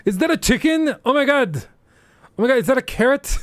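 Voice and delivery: very high, loud confused yelps; squinting streamer. Retold or imitated. Mocking a blurry stream, very high loud confused squeal. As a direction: guess wildly at a blurry image. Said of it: "Everyone get your eye test book".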